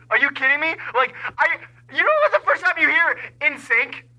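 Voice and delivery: normal talking voice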